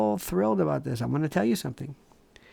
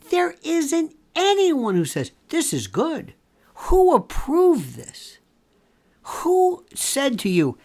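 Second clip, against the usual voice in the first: high-pitched, slow, incredulous challenge; indignant disbelief; 9 semitones higher, slower than usual. Real, not acted. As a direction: demand who on earth allowed it, drawn out and high. Scathing questions to prosecutor.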